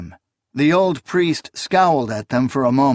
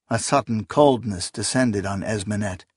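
ordinary delivery

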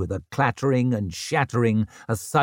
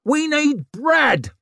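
loud; 3 dB louder, slower than usual. A narrator's reading. Laundress howling at Vic.

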